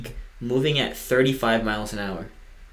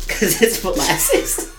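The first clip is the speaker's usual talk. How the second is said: slow, loud words through laughter; laughing punchline; 9 semitones higher, 5 dB louder, slower than usual. Real, not acted. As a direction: crack up while landing the joke. Cracking up at own joke.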